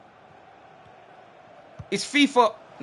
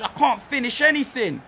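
ordinary delivery

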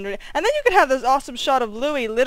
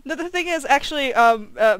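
normal talking voice

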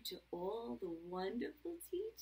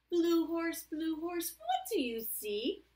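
loud, slow sing-song picture-book voice, higher pitch; reading to children; 7 semitones higher, 13 dB louder, slower than usual. A narrator's reading. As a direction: stretch the answer out for little listeners. Reads picture book aloud.

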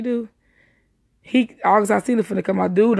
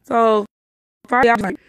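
usual speaking voice

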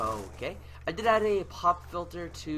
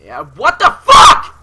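slow, very loud outburst; outraged shout; 23 dB louder, slower than usual. Real, not acted. Gamer outraged by what just happened in the match, loud shout. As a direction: bellow it in real disbelief. Player raging at game.

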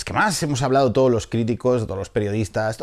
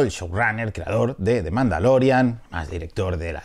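usual speaking voice

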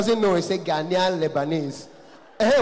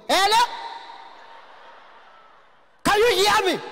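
poor man; 13 semitones higher than usual. Retold or imitated. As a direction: yell shrilly down a bad line. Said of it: "voice is always very high".

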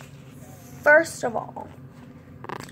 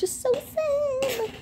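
mock whimper; 10 semitones higher, much slower than usual. Mock cry at forgetting.

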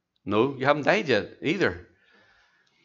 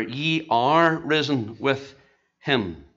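slow, leaning hard on "are" with a lift, then falling away on "him"; stress on ARE; 2 semitones lower, much slower than usual. Shown as a demonstration. Preacher underlining "are" for the congregation, slow and stressed. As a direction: underline "are" by drawing it out and raising it. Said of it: "to underline the word "are".

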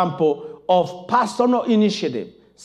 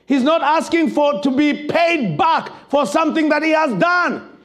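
high, raised sermon voice; preaching at full force; 8 semitones higher than usual. Acted. Preacher driving home the point to the church, fervent preaching. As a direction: preach it out to the congregation.

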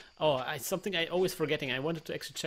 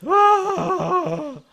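loud high bleating goat call; 16 dB louder than usual. Pretend. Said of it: "That's my goat, goat impression".

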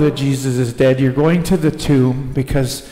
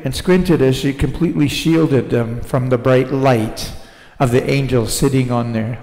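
usual speaking voice

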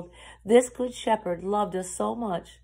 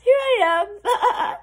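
high, loud bleating calls; desperate little lamb wail; crying lamb; 8 dB louder, slower than usual. A narrator's reading. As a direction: cry out to be found, bleating. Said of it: "she cried".

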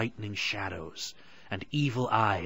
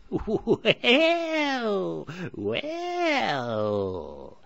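high, loud creaky witch voice; sly hag, drawn out; crafty old woman; 12 semitones higher, 4 dB louder than usual. A narrator's reading. Old woman greets the children, crafty and high. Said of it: "with a crafty look".

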